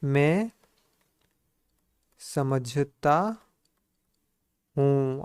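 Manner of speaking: very slow, each word stretched and spaced; word-by-word dictation. Shown as a demonstration. Teacher dictating to the class, very slowly. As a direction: drag it out word by word for writing. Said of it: "क्या हो जाए?".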